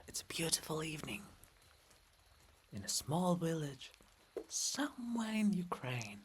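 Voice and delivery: soft whispered ASMR chat; soft whispering vlog aside. Real, not acted. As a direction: whisper gently over the rain. Quiet ASMR-like outdoor aside.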